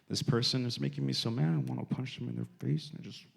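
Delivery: hushed, mock-frustrated prayer under the breath; private confession. Believer praying to God about someone maddening, hushed. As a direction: confide it softly to God.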